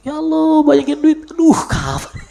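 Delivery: mock-whining, high-pitched prayer; mock pleading prayer. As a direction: beg for money like an impatient believer. Mocking an impatient money prayer.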